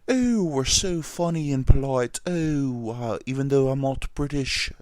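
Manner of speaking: put-on British accent. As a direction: put on a polite, hammed-up British voice. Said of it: "that's a good British accent".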